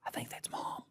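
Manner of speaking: soft whisper to his dad; little boy whispering. Retold or imitated. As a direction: breathe it out secretly, hushed. It looks like he said.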